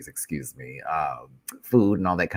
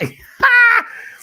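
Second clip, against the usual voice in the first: very high, loud burst of laughter; shrieking laugh; 12 dB louder than usual. Real, not acted. Creator cracking up over friend's tease, loud shrieking laugh.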